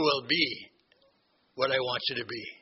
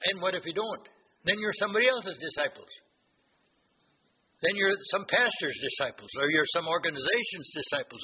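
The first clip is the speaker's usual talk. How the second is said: ordinary talk, nothing marked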